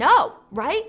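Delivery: loud, high, emphatic refusal; mock-indignant loud rebuff; retold firm refusal. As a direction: put your foot down, retold.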